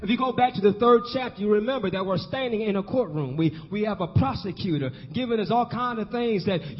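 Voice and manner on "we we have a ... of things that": usual speaking voice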